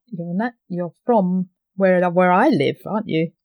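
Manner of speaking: ordinary talk, nothing marked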